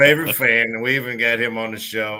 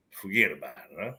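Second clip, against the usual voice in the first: mock Jersey accent; 2 semitones lower, 5 dB quieter, faster than usual. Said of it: "talk like this".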